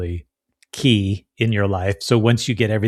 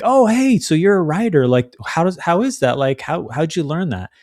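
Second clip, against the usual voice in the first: high, lively lunch-table questions; curious friend; 7 semitones higher, 3 dB louder than usual. Acted. Friends at lunch asking a writer questions, high chatty curiosity. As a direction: pipe up with eager interest. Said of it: "they go like".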